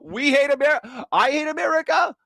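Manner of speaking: high, sneering mock admission; sarcastic mock confession. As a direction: play the accusation back in disbelief.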